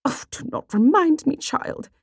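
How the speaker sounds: high, put-upon mother dropping off at the end; exasperated high-pitched huff; huffy. Acted. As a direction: huff it out, vexed at your child. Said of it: "she huffed".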